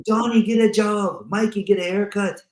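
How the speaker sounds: a touch of mock nagging at family; dead man scolding. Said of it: "He goes".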